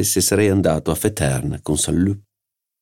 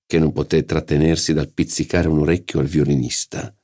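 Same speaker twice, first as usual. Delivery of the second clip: ordinary delivery, nothing marked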